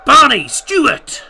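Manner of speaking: light PA-style substitution call; announcer read. Acted. Streamer lightly mimicking the stadium announcer. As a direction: read it like the tannoy, half laughing.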